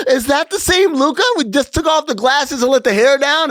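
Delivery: mock amazed. The school crowd seeing Luka transformed, mock astonished.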